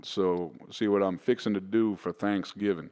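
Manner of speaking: slow, down-home Texas drawl; slowed-down Texan accent. Shown as a demonstration. Australian showing hosts his American accent, slow Texan drawl. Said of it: "want me to slow it right down?".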